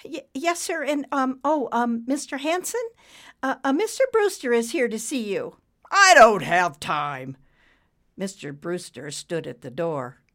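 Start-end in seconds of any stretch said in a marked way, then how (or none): 0.1-5.6 high, stammering, hesitant voice; timid secretary, flustered. A narrator's reading. Secretary announcing a visitor to her boss, flustered. 5.9-7.4 loud, slow, emphatic refusal; irritated refusal. A narrator's reading. Boss refusing a visitor, irritated.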